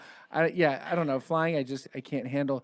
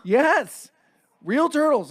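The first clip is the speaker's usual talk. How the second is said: insistent vendor; 8 semitones higher, 5 dB louder, much slower than usual. Retold or imitated. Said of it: "It's a real turtle".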